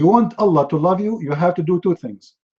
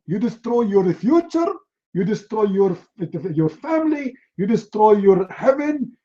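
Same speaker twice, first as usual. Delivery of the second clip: mock-indignant court condemnation, pitched up; hammed-up scolding that climbs higher as it goes; outraged judge; 4 semitones higher than usual. Sharia court judge condemning a yawner, mock outrage. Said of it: "they say to you".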